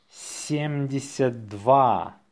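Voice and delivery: slow, drawn-out dictation. Shown as a demonstration. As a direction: say it slowly for learners. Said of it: "say it slowly".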